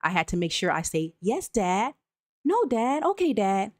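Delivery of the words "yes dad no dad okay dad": higher, dutiful scripted replies; robotic eager-to-please child. Retold or imitated. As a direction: answer dad promptly, like a people-pleaser. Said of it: "that's how I answered".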